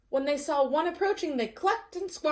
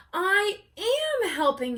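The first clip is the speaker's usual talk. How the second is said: slow, higher, pleased with himself; smug peacock; 7 semitones higher, 5 dB louder, slower than usual. Acted. Peacock thinks to himself, smug and slow. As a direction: savour a secret triumph.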